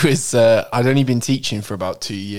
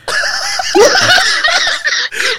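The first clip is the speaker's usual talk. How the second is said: high shrieking laugh; 4 dB louder than usual. Real, not acted. Howling laugh at the punchline.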